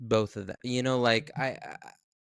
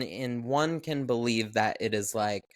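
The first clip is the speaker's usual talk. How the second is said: usual speaking voice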